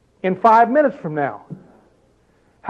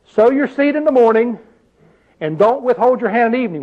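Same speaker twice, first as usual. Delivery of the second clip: higher, raised reading of the verse; scripture reading; 5 semitones higher than usual. Acted. Preacher rephrasing the verse for the congregation, raised. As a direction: proclaim the verse to the room.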